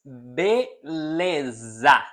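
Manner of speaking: "beleza" slowly broken into be-le-za; slow, separated syllables on "beleza". Shown as a demonstration. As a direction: teach "beleza" one stretched syllable at a time. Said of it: "Repeat with me".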